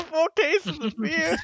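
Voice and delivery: high, slow laughing repeat. Real, not acted. Streamer laughing at a friend's Canadian beer joke, high and slow. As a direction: crack up while repeating it.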